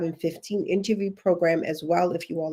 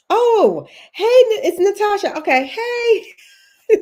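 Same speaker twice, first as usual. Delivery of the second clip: much higher, delighted hello; excited greeting; 13 semitones higher, 7 dB louder, slower than usual. Real, not acted. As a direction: greet a friend spotted in chat. Greeting a viewer, Natasha, live.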